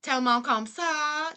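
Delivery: slow Cajun French with an anglophone twang; Cajun French accent. Shown as a demonstration. Streamer showing viewers how Cajun French sounds, twangy Cajun accent. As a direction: stretch it out in Cajun-style, English-accented French. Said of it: "that sounds like Cajun".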